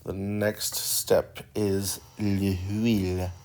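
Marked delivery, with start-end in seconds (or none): 1.6-3.3 drawn-out, hammed-up French accent on l'huile; mock French accent. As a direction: say the next step like a teasing Frenchman, slowly. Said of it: "with their funny little silly French accents".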